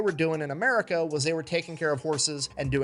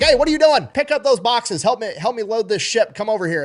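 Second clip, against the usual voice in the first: loud barked orders, high and loud at first then easing; a dock boss yelling at a kid to get to work; retold yelling; 6 semitones higher, 6 dB louder than usual. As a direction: dock worker shouting orders at the boy. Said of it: "somebody just starts yelling at him like".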